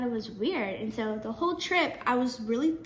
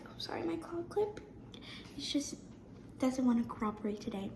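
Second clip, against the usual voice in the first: quiet aside; 10 dB quieter than usual. Real, not acted. Aside while fixing her hair clip.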